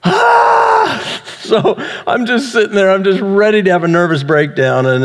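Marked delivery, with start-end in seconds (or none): 0.0-1.2 long high-pitched mock wail; exasperated cry. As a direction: let out a hammed-up squeal of frustration. Hand slips, exasperated.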